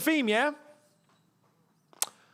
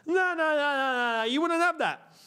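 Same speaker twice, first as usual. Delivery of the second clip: indignant English fan; 7 semitones higher than usual. Acted.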